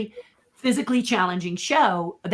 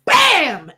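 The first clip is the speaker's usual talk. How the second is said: higher and loud 'Bam'; mock impact bam; 8 semitones higher, 9 dB louder than usual. [name] showing the hit on Eisner's head, a loud bam. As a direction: smack the word like a hit landing. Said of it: "and I went".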